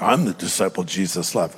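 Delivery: low, soft mock bragging; mock boastful. Preacher showing how John's words could be misread, mock boastful. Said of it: "That almost might sound boastful".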